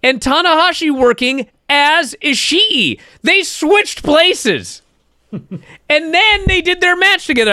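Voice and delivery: building thrill as the twist lands; excited. Real, not acted. Host to listeners, thrilled by the wrestlers' role swap, getting excited. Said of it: "I'm so excited".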